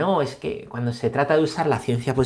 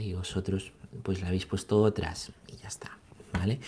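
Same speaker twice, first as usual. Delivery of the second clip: whispered dismissive aside; 6 semitones lower, 9 dB quieter than usual. Real, not acted. Priest answering the Jehovah's Witnesses, whispered aside. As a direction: drop to a whisper, shrugging it off.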